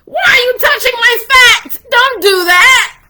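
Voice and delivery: acted high, loud cringing shriek; hammed-up panicked protest, shouted; freaking out. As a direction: yelp in alarm at being touched. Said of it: "I freaked out".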